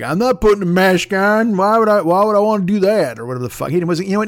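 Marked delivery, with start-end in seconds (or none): 0.0-3.2 a light put-on country accent, louder and pushier; mock country accent. Customer refusing the Costco manager, lightly hammed country accent. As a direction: play him as a country bumpkin refusing, in a country accent. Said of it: "the dude's just like".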